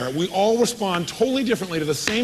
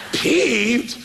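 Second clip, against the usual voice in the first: mock disbelief; 7 semitones higher, 4 dB louder than usual. Mock disbelief at the odd word.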